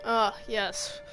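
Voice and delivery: normal talking voice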